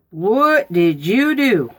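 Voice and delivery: put-on a mother confronting her muddy kid; mom demanding. Mom to muddy daughter, slow loud demand.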